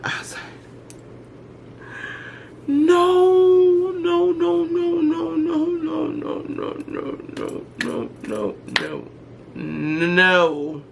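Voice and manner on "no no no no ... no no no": high wailing no. Retold or imitated. Son announces moving out.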